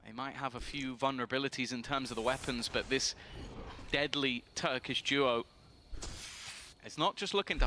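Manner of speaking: play-by-play caster voice; esports caster. Acted. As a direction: call the round like a live caster. Live play-by-play casting.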